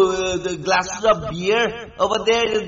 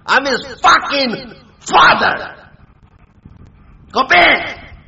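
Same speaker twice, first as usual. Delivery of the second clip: loud, raised, slow declaration; angry command; 4 semitones higher, 6 dB louder, slower than usual. Real, not acted. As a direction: assert it forcefully, word by word. Angry challenge and order.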